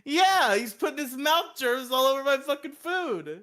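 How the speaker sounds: high, loud, indignant rant; hammed-up disgust; mock outrage. Mock outrage about food tampering.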